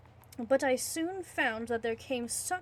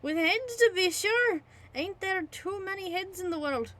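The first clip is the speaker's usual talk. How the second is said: gleeful innkeeper, pitched up; high, louder landlord; 7 semitones higher, 5 dB louder than usual. Acted. Landlord gleefully answers the narrator, high and loud. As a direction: crow it like the landlord.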